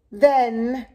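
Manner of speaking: ordinary delivery, nothing marked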